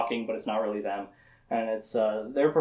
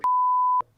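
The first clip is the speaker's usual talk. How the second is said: high giggle. Real, not acted. Laughs mid-retelling.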